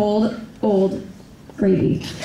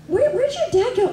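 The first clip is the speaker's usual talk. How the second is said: much higher, puzzled mock voice; high-voiced questioner; 9 semitones higher than usual. Relative asking where dad went, high mock puzzlement. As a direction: ask it in a high imitation voice.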